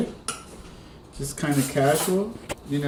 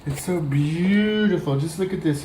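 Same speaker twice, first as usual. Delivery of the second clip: mock gushing voice. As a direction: stretch "so gooey and beautiful" like a doting TV cook, a hint only. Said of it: "I know who I sound like when I say that".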